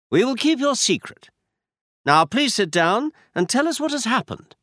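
brisk, sure Holmes explaining a clue; Holmes, lightly voiced.

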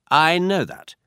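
brisk, sure Holmes explaining a clue; Holmes, lightly voiced.

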